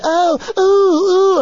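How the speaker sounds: very high, loud mocking "ooh"; loud, high, mocking swoon sounds. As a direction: coo like a thrilled admirer. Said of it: "jumping, 'cause it's so much".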